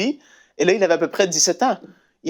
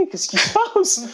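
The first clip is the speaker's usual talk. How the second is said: mother's question. Retold or imitated. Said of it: "sa mère a dit".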